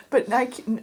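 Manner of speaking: ordinary talk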